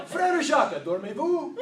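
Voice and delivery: half-sing it like a fake Frenchman; mock French accent. Shown as a demonstration. Speaker reciting the nursery rhyme in a mock accent. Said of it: "my entire French vocabulary".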